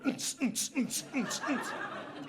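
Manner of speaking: high mouthed techno beat; club beat imitation. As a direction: imitate dance music that never stops. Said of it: "Toute la journée". (imitation de boum-boum techno)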